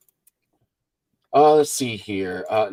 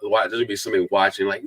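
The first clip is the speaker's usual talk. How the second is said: ordinary delivery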